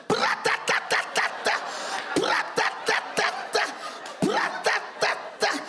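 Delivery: breathless unvoiced scat bursts; jazz-scat diarrhoea. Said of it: "my shit sounds like a jazz singer".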